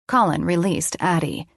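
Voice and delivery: ordinary delivery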